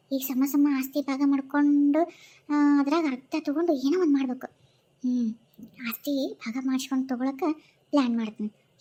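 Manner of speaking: low, hushed plotting to herself; scheming aside. Acted. Wife plotting against her in-laws, low and hushed. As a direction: mutter the plan like a schemer alone.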